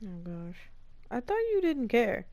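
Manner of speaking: normal talking voice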